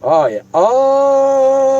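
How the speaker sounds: high, drawn-out yelps as cold water hits; cold-water shock. Real, not acted. Dumping water on his head.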